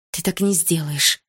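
ordinary delivery, nothing marked

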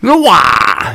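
sharp high battle yell; battle cry. Acted. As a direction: shout it like a kung fu fighter. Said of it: "с боевым воплем".

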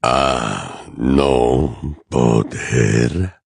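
low, drawn-out, chilling figure; cold villain voice. A narrator's reading. Voldemort revealing himself to Potter, cold low voice. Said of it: "una voz fría y aguda".

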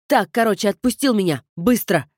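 loud demanding shout; yelling. Acted. Sister yelling at her brother to let go. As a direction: bark the order at him. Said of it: "заорала я на братца".

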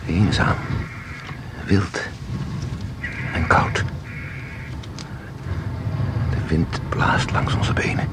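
soft whisper, quickening at the end; low, breathy scene-setting whisper; hushed. Acted. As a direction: whisper the wind in, like telling a secret. Guide evoking cold wind.

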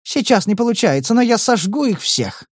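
high, shrill threatening cry; high-pitched. Acted. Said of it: "ответив на высокой ноте".

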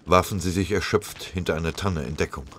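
ordinary delivery

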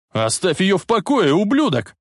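high, urgent acted yell; angry yell. A narrator's reading. Boy defends girl from bully, angry high yell. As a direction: pitch it up and yell in protest. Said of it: "заорал Марти".

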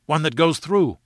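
excited cry. A narrator's reading. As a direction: blurt it out in excitement. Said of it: "I cried".

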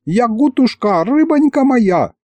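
high, slow sugary fawning; fawning old man. Acted. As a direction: sweet-talk her slowly. Дед заискивает. (заискивающий дед)

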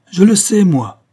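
loud, high dramatic shout; ringing, forceful announcement; powerful cry. A narrator's reading. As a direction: burst in with a powerful voice. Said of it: "cria une voix puissante".

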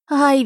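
put-on sharp boyish cry of pain; high squealed yelp. A narrator's reading. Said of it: "верещал тот". (визгливое «ай» от боли)

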